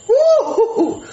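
high, loud laughing woo-hoo; gleeful whoop. Acted. Chef coming back downstairs, high gleeful whooping. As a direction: whoop with delight.

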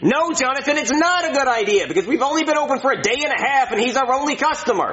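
high-pitched, fed-up rebuke; exasperated scolding. Acted. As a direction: scold your hopeless brother. Exasperated brother scolds Jonathan.

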